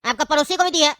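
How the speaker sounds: loud outraged comeback; furious retort. Acted. Milkman defends his wife against Seth, loud furious retort. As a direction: shout him down in outrage.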